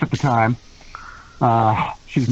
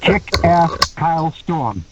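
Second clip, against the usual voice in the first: name spaced out slowly; drawn-out, emphatic repetition; slow, deliberate repeat; 2 semitones lower, much slower than usual. Retold or imitated. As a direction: say it again at half speed, word by word. Repeats name after "What?.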